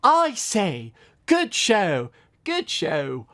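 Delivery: hammed-up British gentleman; put-on British voice. Reciting his old pony video to viewers, mock posh British. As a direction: play a silly stuffy Englishman. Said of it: "I put on a voice and I say".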